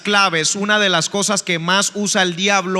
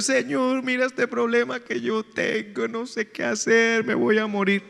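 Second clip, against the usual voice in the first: softer, whiny imitation of a crying plea; mock crying prayer; 4 semitones higher, 6 dB quieter than usual. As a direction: play up a childish tearful complaint to God. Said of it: "yo empiezo a llorar". (imitando un llanto quejumbroso y suave)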